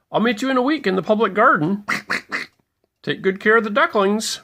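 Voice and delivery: a brisk goodbye from a bustling duck dad; Mister Mallard's voice. Acted.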